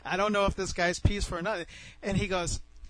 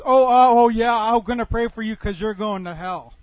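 booming, mocking agreement; acting out a heated opponent; loud scornful retort; 3 semitones higher, 10 dB louder than usual. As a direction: burst out over him with scorn. Opponent's heated sarcastic reply acted.

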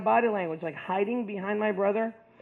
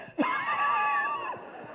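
big burst of laughter; 4 dB quieter than usual. Real, not acted. Laughing at his own hospital story. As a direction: break into a real laugh mid-story.

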